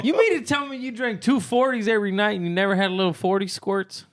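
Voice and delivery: high, disbelieving tease; high incredulous teasing. Real, not acted. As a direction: push him with mock disbelief.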